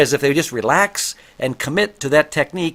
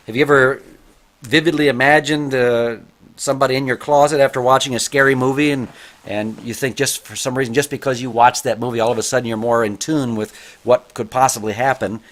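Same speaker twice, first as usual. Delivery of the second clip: usual speaking voice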